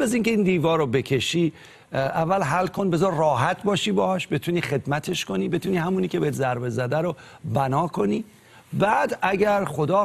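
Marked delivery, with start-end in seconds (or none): none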